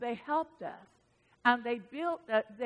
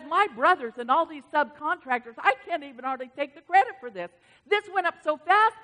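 louder, excited retelling; amazed builder; 4 semitones higher, 9 dB louder than usual. Retold or imitated. Builder amazed at the speed to the pastor, loud and excited. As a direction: quote him in loud, animated wonder.

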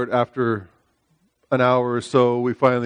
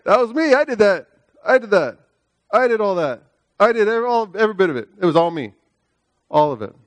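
lightly voiced boasting, higher; boastful; 8 semitones higher than usual. Officer mocking his swollen pride, light boast. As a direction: gloat about taking credit, a bit higher. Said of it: "I was walking around like".

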